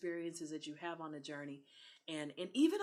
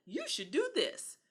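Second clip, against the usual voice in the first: high-pitched imitation of eager encouragers; quick, high, mock-excited urging; 12 semitones higher, 5 dB louder than usual. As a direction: gush at someone in a high voice. Acting out people urging her.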